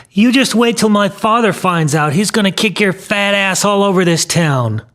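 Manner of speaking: put-on high-pitched, defiant kid voice; boy crying out. A narrator's reading. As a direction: cry out a boy's threat. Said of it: "He cried".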